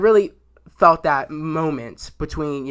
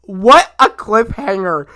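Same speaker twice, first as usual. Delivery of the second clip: slow, loud, high marvel; awed exclaim; 3 semitones higher, 7 dB louder, slower than usual. Retold or imitated. Fan reacting to the episode ending, slow and loud. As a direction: savour the twist slowly.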